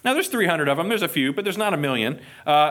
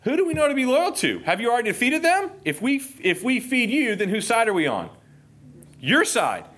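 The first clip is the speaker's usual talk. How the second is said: higher, light mock of cowardly officials; snooty town officials; 7 semitones higher than usual. Pastor voicing Sukkoth's officials refusing bread: higher, mocking. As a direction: play the town elders brushing Gideon off.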